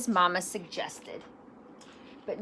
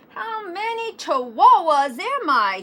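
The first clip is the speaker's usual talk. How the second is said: high, louder, amazed question; wondering out loud; 8 semitones higher, 8 dB louder than usual. A narrator's reading. Kitty boy asking his reflection, loudly wondering. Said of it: "he wondered out loud".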